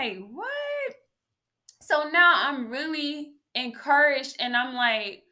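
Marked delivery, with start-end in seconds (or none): 0.3-0.9 high-pitched, soft incredulous "what". Retold or imitated. Reacting to the unexpected Spanish lesson, incredulous. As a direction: let the word leap up high in disbelief. Retold shocked reaction in testimony.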